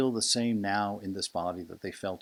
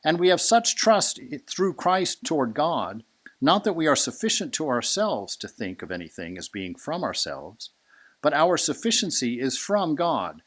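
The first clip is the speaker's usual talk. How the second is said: fiery scripture reading; 7 semitones higher, 7 dB louder than usual. Acted. Reading Paul's letter to the congregation, booming and high. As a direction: thunder the apostle's words from the pulpit. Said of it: "He says".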